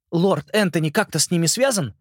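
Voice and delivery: put-on a boy urgently pleading his case; earnest boy. Simon's lines.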